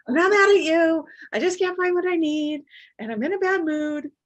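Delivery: high, loud frazzled yell; narrated yell. Retold or imitated. Mom explaining her bad mood to the family, yelling. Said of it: "we will give it narration why".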